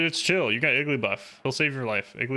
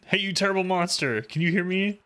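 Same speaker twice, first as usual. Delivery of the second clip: higher, cocky taunt at the monster; higher mock bravado yell; taunting dub voice; 6 semitones higher than usual. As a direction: call out the monster like a brat. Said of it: "he's talking smack".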